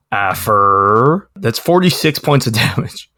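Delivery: ordinary talk, nothing marked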